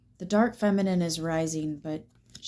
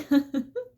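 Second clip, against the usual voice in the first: laughing through tears; 7 semitones higher than usual. Real, not acted. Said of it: "you made me cry".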